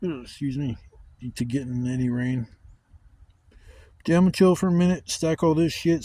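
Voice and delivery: ordinary talk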